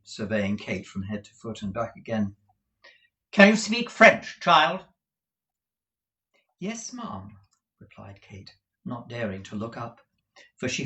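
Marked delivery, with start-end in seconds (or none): 3.3-4.9 high, loud questioning madam; imperious shop mistress, pitched up high; brisk Madame Mantalini. Acted. Madam quizzes Kate about French, high and imperious. 6.6-7.4 high, soft reply; small, high voice of a timid girl; meek Kate. Acted. Kate answers madam without daring to look up, meek.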